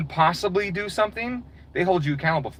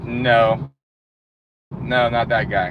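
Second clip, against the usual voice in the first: lower, curt mock rejection; a hint of a bored interviewer saying no; dismissive interviewer; 4 semitones lower, slower than usual. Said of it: "they're like".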